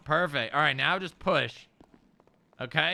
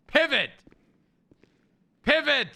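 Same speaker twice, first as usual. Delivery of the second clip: high, loud barked order; shouted command; 10 semitones higher, 4 dB louder than usual. Real, not acted. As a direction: command them to turn. Repeats louder as command.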